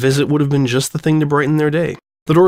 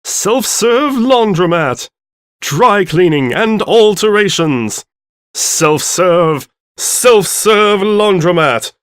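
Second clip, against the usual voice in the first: slow, louder, much higher announcer; booming sign voice; 7 semitones higher, 6 dB louder, much slower than usual. Acted.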